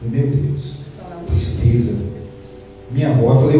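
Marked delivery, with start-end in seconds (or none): 0.0-1.9 sad recollection. Retold or imitated. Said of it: "Fiquei triste".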